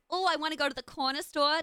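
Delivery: light, high Harley Quinn-esque accent; uplifted accent. Shown as a demonstration. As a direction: keep the New York accent bright and lifted. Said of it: "I need to stay uplifted".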